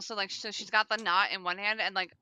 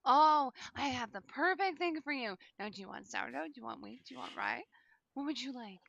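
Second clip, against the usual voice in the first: grandma voice; 6 semitones higher than usual. Acted. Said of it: "she goes".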